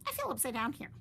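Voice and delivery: a lightly voiced bird. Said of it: "Flap said".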